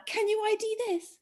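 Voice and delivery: high, put-on questioning voice; riddle voice. Quizmaster explaining the clue to friends, put-on riddle voice. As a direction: lift it high like a little challenge. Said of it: "one of those things that is like".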